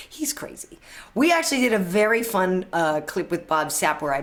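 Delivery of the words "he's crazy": a soft unvoiced whisper; whispered aside. Real, not acted. Host whispers to viewers about Bob Sapp, whispered. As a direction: lean in and whisper it like a secret.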